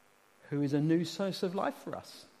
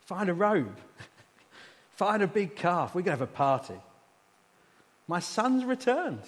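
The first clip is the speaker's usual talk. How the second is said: loud, higher celebratory call; overjoyed father; 3 semitones higher, 6 dB louder than usual. Acted. Father calling the household to celebrate his son, loud and jubilant. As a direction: call out the good news like a delighted host. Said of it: "Father said".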